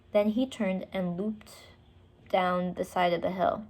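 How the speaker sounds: usual speaking voice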